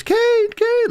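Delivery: very high falsetto mimicry; Paul Bearer. Tape recorder in a dummy calls Kane, high mock squeal. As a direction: imitate a squealing recorded call. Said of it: "like, his voice".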